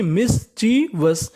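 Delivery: Mischievous slowly as mis-chee-vas; syllable by syllable. Shown as a demonstration. Teacher repeating the word for learners, syllable by syllable. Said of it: "meaning क्या बन गई".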